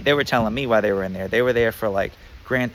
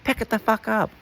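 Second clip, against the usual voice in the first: very high angry imitation; mock guard bark; 12 semitones higher than usual. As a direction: snap it at him, pitched way up. Guard orders inmate.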